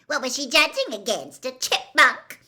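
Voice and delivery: loud, high, sneering jeer; a spiteful schoolgirl mocking a rival. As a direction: jeer at her to make the others laugh. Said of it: "Pansy Parkington had shrieked".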